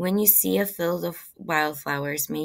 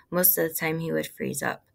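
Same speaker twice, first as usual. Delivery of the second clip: usual speaking voice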